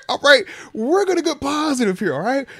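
pumped, high and loud, steering away from death talk; excited, getting loud. Real, not acted. Host turning 36 hypes himself up, high and loud. Birthday tomorrow, refuses dark topic.

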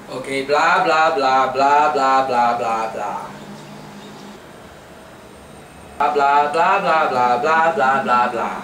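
a light Singlish lilt on nonsense blah blah; imitating a Singlish-accented lecture with just blah blah. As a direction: mimic the Singlish teacher babbling in class. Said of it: "this is one example. Here we go".

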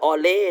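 "alley" misread as "aw-lay"; mispronounced alley. Shown as a demonstration. Teacher forbidding this reading to students, "alley" mispronounced as "aw-lay". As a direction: give "alley" a wrong aw vowel and -lay ending. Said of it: "ห้ามอ่าน alley".

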